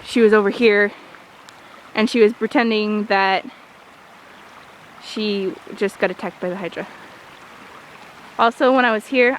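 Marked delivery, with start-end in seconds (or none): none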